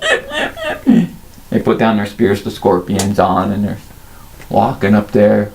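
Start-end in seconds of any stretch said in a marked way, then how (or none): 0.0-1.1 high, tiny kobold yips; yipping kobolds. Acted. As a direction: yap like a pack of little kobolds. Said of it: "And they're like".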